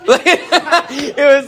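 high squeaky imitated laugh; imitating friend's laugh. Youth pastor imitating roommate's laugh, high squeaky giggle. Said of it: "he laughed like".

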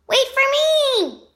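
high, slow frightened wail. Acted. Little brother left in the dark, wailing. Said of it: "Ryder's wails filled the night".

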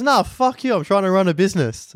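high-pitched retold protest; indignant refusal. Shop guy refusing his loyal customer a freebie, high indignant imitation. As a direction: imitate an exasperated seller, pitch jumping up. Vape seller refusing a free replacement.

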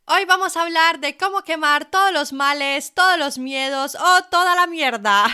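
mock-grand welcome with punched words; joking hype intro. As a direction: announce it big, as a joke. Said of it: "Bueno, bromas aparte".

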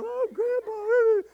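put-on high, slow, whining little girl; drawn-out whine. Granddaughter begging grandpa to put her shoes on, high slow whine. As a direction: stretch it out like a begging five-year-old. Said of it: "She comes".

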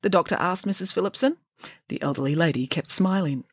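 plain reading voice

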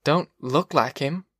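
slow, mildly groggy answer; dazed, sickly. A narrator's reading. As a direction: drag it out slightly, as if dazed. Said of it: "The dazed man stared sickly".

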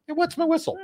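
jumps up high for the idiom; high playful voice. Shown as a demonstration. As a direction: pitch it way up, tongue in cheek. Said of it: "what's the word I'm looking for".

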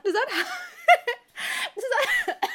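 helpless high laughter breaking up the question; laughing. Real, not acted. Woman asks friend after her impression, cracking up laughing. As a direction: try to ask while cracking up.

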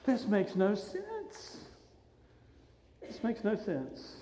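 slow, very soft disbelief; hushed bafflement. Acted. Preacher puzzling over the folded grave cloths, hushed bafflement. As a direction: drop to a near whisper, drawing it out.

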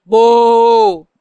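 loud crowd boo. Shown as a demonstration. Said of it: "si yo hago así, ustedes van a decir".

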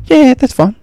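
put-on high, eager boy; keen kid voice. Son agreeing to a hundred miles, high and eager. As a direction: wave it away like a keen son. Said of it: "He goes".

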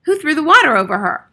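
high, loud questioning; sharp demand. A narrator's reading. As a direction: demand an answer from the room. Matron demands to know culprit.

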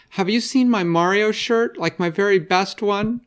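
put-on a small boy pestering his sister; little boy voice. Mikey asks his sister about his shirt in a high little-boy voice.

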